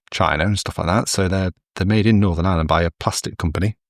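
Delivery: ordinary delivery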